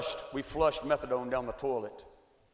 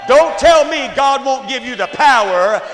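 loud, rising sermon build; preaching at full force; 6 semitones higher, 13 dB louder than usual. Acted. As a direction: build the crowd to a roar. Preaching climax.

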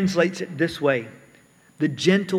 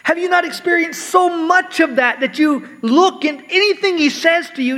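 higher-pitched, insistent appeal to a believer; pressing rhetorical question; 9 semitones higher than usual. Acted. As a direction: press the question as Paul asking. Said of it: "as if to ask almost".

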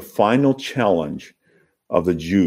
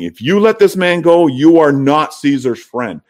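threatening crowd; 7 semitones higher, 4 dB louder than usual. Acted.

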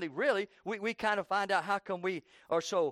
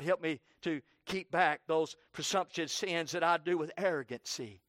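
ordinary talk, nothing marked

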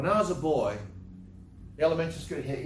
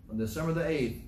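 normal talking voice

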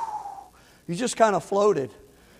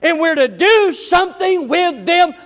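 louder preaching with rising intensity; 5 semitones higher, 5 dB louder than usual. Acted. Pastor urging the church to faithful stewardship, preaching hard. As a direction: drive the point home to the congregation.

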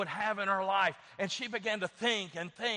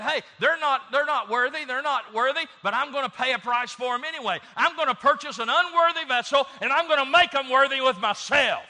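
loud, high preaching at full force; impassioned declaration; 6 semitones higher, 8 dB louder than usual. Acted. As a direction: proclaim it loudly, pitched up high. Preacher voices God's passionate declaration.

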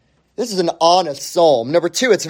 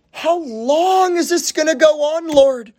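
anguished plea; 9 semitones higher than usual. Acted. Voicing the psalmist's anguished cry.

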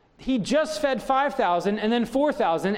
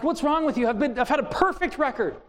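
exasperated parent; 6 semitones higher than usual. Retold or imitated. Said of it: "And I'm like".

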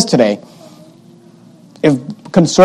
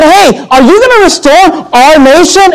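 eager disciples; 9 semitones higher, slower than usual. Retold or imitated. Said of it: "They said".

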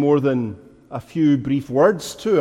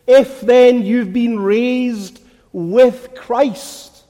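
slow, raised scripture proclamation; forceful preaching; 5 semitones higher, 4 dB louder, slower than usual. Real, not acted. Preacher reading Paul's argument to the congregation, forceful preaching. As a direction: declare the verse in weighty, drawn-out phrases.

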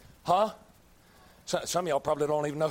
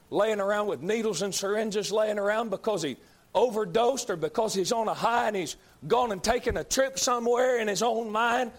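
normal talking voice

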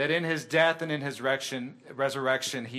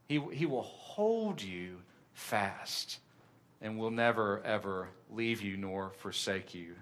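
tender reassurance; 5 semitones lower, 6 dB quieter than usual. Real, not acted. Preacher comforting listeners that God won't let go, softly. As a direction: drop down and reassure them gently. Assurance to listeners after climax.

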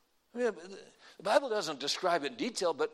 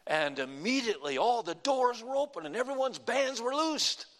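higher and louder, building to a cry; preaching at full force; 6 semitones higher, 4 dB louder than usual. Acted. Paul calling to the jailer, loud cry. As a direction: cry it out like Paul in the dark prison.

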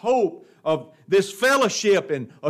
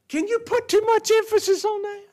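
much higher, incredulous challenge; pitched-up rhetorical cry; incredulous retort; 13 semitones higher than usual. Real, not acted. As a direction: fire the question back at the critics.

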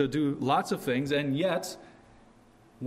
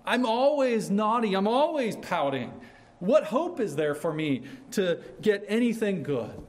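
put-on high-pitched, exasperated child; despairing little kid; 7 semitones higher, 3 dB louder than usual. Little kid who can't keep being good, high despairing plaint. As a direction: plead like a kid who gives up. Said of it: "you're the little kid who's, who knows".

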